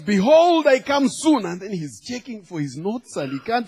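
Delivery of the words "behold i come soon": high, loud preacher's declaration; hammed-up announcing of a sermon title; acted pulpit proclamation. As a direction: proclaim it to the whole congregation. Acting pastor declaiming sermon title.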